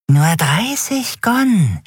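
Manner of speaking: high, slow, drawn-out offer; creepy price pitch. Acted. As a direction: dangle the price slowly. Dealer nennt seinen Preis. (hoch, langsam, gedehnt angeboten)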